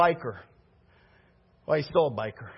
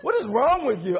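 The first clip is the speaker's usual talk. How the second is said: exasperated disbelief; 6 semitones higher than usual. Retold or imitated. Baker scolding the prank-playing pastor, exasperated. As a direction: shake your head at him in disbelief. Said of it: "he goes".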